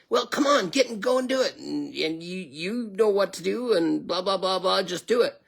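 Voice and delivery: high, dismissive mock-brat voice; whiny manager. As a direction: wave him off like a spoiled boss. Manager brushes him off again.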